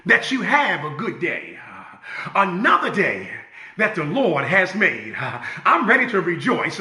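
ordinary delivery

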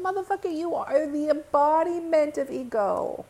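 cursing him out. Retold or imitated. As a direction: tell him off, drawing out the accusation. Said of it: "Do you like how I cursed?".

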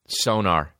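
normal talking voice

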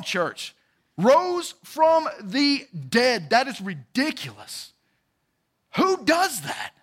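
high, loud, drawn-out revival preaching; exultant, amazed pulpit cry; fired-up preaching. Real, not acted. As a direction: rouse the congregation, stretching the key words. Preacher peaks on the resurrection.

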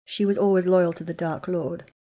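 plain reading voice

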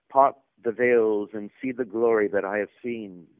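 mock biblical prophet. Child of labor's speech.

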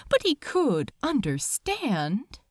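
usual speaking voice

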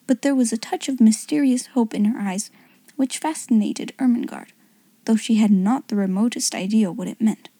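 ordinary delivery

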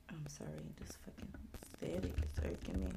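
muttered under the breath, unvoiced; hushed annoyed whisper; whispered aside. Real, not acted. Aside complaining about the static.

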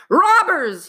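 high, loud shout of alarm; doctor shouting on waking; alarmed cry. Acted. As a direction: wake up yelling about robbers.

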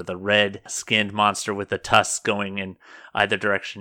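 ordinary talk

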